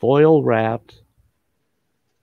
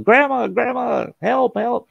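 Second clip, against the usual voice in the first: yelling for help. Retold or imitated. Boy at the door yells to grandma for help. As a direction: call out for help, pitch way up.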